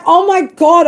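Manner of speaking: high, loud gasp of delight; sudden thrilled discovery; delighted surprise. Retold or imitated. Smelling the basil, delighted.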